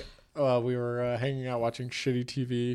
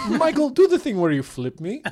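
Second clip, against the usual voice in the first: put-on high, loud Ukrainian accent; Natalie; 13 semitones higher, 8 dB louder than usual. Bride asking Michael to flip her, high Ukrainian accent. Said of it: "She talks like this".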